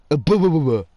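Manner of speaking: exaggerated blubbering mush; mock blubbering gibberish. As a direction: babble "blah, blah" as mangled British speech. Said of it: "sounds like".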